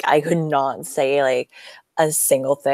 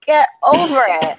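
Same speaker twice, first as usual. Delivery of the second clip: curt, higher "get over it" brush-off; dismissive; 8 semitones higher, 5 dB louder than usual. Retold or imitated. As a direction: brush them off, impatient. Mock dismissive reaction to crying.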